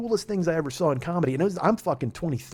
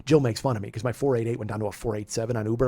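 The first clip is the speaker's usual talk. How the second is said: normal talking voice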